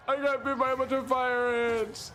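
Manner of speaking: mock-tearful child telling mom about the ant bites; whiny crying kid. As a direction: play the hurt six-year-old complaining to mom.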